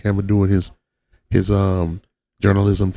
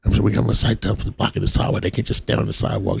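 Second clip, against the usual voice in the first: Sergeant Thompson; 6 dB quieter, faster than usual. Retold or imitated. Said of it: "Sergeant Thompson".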